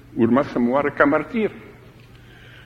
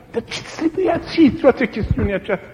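lightly higher, mocking inner voice; tempting inner voice; 7 semitones higher than usual. The martyr's tempting thoughts before death, lightly mocking. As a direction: needle the martyr with doubt. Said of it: "îi vin așa niște gânduri".

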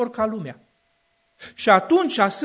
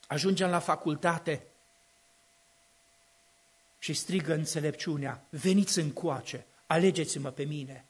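much softer, lower delivery; hushed preaching; 6 semitones lower, 8 dB quieter than usual. Acted. Preacher quietly warning about university years, hushed. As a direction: drop the voice to draw them in.

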